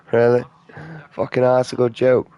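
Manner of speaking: hammed-up glee at a bad joke; imagined listener gushes over the nonce joke; mock delight.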